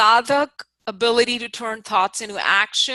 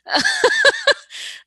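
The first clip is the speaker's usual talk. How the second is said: sudden high laugh; amused laugh at her own joke; high burst of laughter; 4 dB louder than usual. Real, not acted. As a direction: laugh out loud at yourself.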